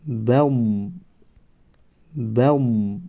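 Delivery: بم read slowly, lips closing; syllable demo. Shown as a demonstration. Teacher shows بم to the class slowly. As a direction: model بم slowly with no vowel on ب. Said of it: "تلفظ می شود که".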